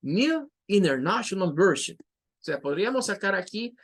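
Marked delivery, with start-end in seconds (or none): 0.0-2.0 New said as ni, International Version; ni ineɾnaʃjonal version; another variant. Shown as a demonstration. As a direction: another pronunciation of the phrase. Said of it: "otra forma sería decir".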